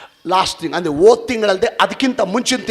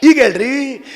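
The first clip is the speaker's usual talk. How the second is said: loud, higher preaching challenge; fiery preaching; 8 semitones higher, 8 dB louder than usual. Acted. Preacher demanding an answer from the congregation, shouted. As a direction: call the question out to the whole church.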